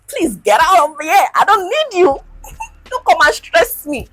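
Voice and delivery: high, loud, laughing shoo-away; half-joking, shove the tracker out of your life; mock dismissal. Talking to deleted period tracker.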